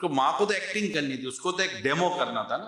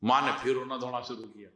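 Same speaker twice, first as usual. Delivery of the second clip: normal talking voice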